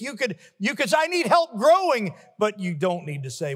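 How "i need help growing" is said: higher, louder plea; pleading quote. Acted. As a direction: cry out for help. Voicing a believer's plea.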